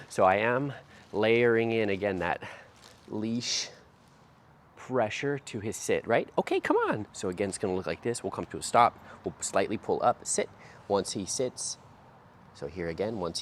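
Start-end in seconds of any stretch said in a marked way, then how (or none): 6.4-7.1 much higher, bright go cue; high dog cue. Real, not acted. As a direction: lift it up for the dog. Cueing puppy to move.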